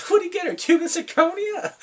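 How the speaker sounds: high, loud scoffing question; loud high mock outrage; mocking disbelief. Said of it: "I was like".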